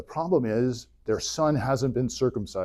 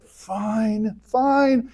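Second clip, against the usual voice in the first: exasperated, the second fine jumping up high; fed up, giving in with a huff; annoyed; 9 semitones higher than usual. Retold or imitated. God, annoyed at Moses, snapping in exasperation. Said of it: "God's getting a little annoyed".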